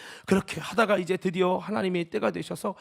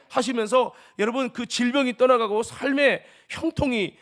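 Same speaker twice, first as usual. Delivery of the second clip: fervent preaching; 2 semitones higher, 8 dB louder than usual. Acted. Preaching at full force.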